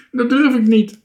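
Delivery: high, loud whine; wailing. A narrator's reading. Scared Toontje up in the tree, high loud wail. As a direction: wail it like a scared little child. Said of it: "jammerde Bange Toontje".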